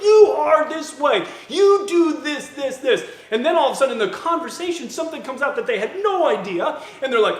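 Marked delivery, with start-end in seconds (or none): 0.0-3.1 loud, high mock accusation; accusing. A preacher mimics people storming into a conflict, loud and accusing. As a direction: hammer out each word, drawn out and forceful.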